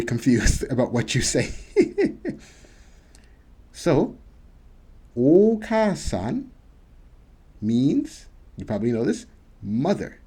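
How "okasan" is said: teacher models "Okasan" with a held first vowel; Okasan model word. Shown as a demonstration. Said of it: "You see this line here?".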